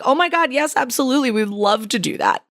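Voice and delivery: sudden high, eager agreement; eager yes. Retold or imitated. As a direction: jump at the chance, delighted. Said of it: "And they were like".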